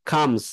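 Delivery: কামস ending in a buzzing z sound; comes with z. Shown as a demonstration. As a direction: end কামস on a voiced z. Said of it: "জ উচ্চারণ হবে".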